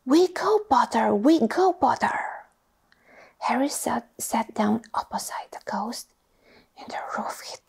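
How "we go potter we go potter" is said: loud, high cheering chant; twins yelling. Acted. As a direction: yell it like excited twins at a feast. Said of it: "the Weasley twins yelled".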